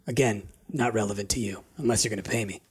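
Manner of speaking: lightly voiced sneaky lizard folk; sly thief voice.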